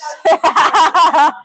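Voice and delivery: rapid run of laughter. Real, not acted. Streamer amused by vegan Bruce, laughing.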